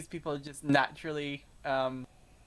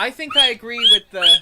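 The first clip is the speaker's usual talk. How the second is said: loud; 5 semitones higher, 14 dB louder than usual. Real, not acted. Interrupted by noise.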